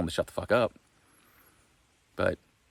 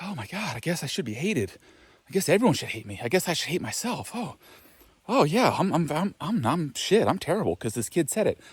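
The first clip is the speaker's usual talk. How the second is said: whiny self-pity pitched much higher; mock self-pity; 8 semitones higher than usual. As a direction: play the wounded pushover, whimpering. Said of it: "listen and be like".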